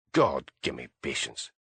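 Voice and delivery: a low, weary groan of patience; exasperated. A narrator's reading. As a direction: mutter it, fed up. Blair exasperated.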